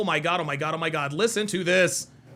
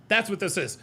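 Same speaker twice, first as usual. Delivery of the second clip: normal talking voice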